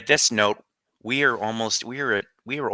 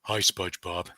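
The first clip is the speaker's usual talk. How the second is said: soft, dopey cartoon friend; quiet cartoon impression; Patrick; 3 semitones lower, 8 dB quieter than usual. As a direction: say it low-key, like a dim cartoon pal. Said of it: "here's my Patrick impression".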